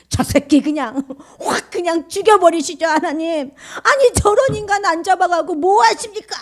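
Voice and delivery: hammed-up screaming curse-prayer; imitating a wife bellowing at God about her husband; yelling prayer. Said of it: "고래고래 소리를 지르면서".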